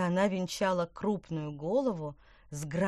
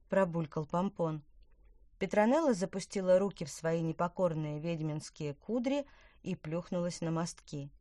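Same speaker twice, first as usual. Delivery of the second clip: ordinary delivery, nothing marked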